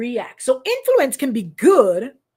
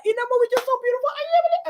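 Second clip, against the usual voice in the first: much higher, fast, swooning voice; gushing over the movie; 12 semitones higher, much faster than usual. Retold or imitated. Retelling to the church her gushing to Apostle over the movie, high fast swoon. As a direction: gush like you're melting over a film. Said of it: "and I'm like".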